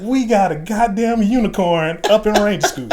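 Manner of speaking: put-on loud, high Southern-accented announcement; imitated Southern instructor, hollering through amusement; strong Southern accent. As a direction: proclaim it in astonishment with a Southern twang. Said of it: "strong Southern accent or whatever".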